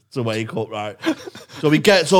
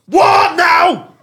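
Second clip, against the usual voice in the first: high, loud fed-up yell of what now; exasperated shout; 8 dB louder, much slower than usual. Retold or imitated. Brother shouting back from the street, high loud and fed up. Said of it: "He went".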